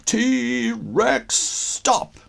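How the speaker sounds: high, loud commanding shout. A narrator's reading. Connor shouts at the rampaging dinosaur, high and loud. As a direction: yell to stop the beast. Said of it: "Connor shouted".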